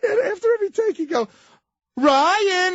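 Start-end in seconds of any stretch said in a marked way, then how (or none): 2.0-2.7 high, loud yell of a name; mocking bellow. Farley taunts the young actor across the set, high loud call. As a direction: call him out to mock him.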